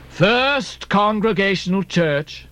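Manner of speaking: slow, hollow, incredulous cry; intoned, drawn-out echo of disbelief; Captain Bildad. A narrator's reading. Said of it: "cried Bildad".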